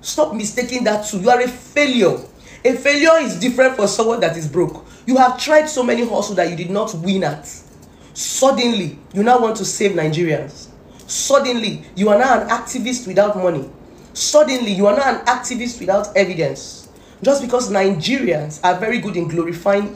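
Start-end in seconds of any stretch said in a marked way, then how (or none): 1.2-4.8 raised, cutting scold; angry scolding. Real, not acted. Berating the blogger as a failure, angry and raised. 8.1-16.9 each Suddenly raised and hit hard; scornful repetition. Real, not acted. Mocking the blogger's sudden activism, scornful.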